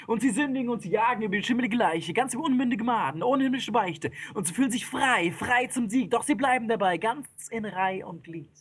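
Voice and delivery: high, driving storytelling; wild, high-pitched epic recital; frenzied narration. A narrator's reading. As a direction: race on breathlessly like a stampede.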